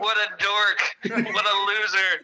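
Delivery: high-pitched jeering taunt; mock scornful tease; teasing mockery. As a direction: rib them like a bullying friend. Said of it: "I'd always give 'em shit".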